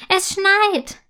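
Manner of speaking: hoch und laut vor Freude gerufen; freudiger Ausruf. A narrator's reading. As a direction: ruf es voller Freude aus dem Fenster. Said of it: "ruft sie voller Freude". (freudig rufend, deutlich höher)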